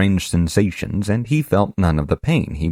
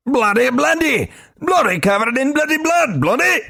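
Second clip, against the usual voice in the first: very high, loud exasperated burst; 3 dB louder than usual. Acted. Globin exasperated with Korash, high loud burst. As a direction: throw up your hands with the word.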